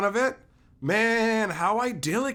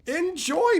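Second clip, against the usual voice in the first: Enjoy pitched way up with a sing-song rise; high teasing Enjoy; 12 semitones higher, 4 dB louder than usual. Shown as a demonstration. Streamer teasing Pat Cash about his two boxes, high sing-song. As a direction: lift Enjoy high and tease.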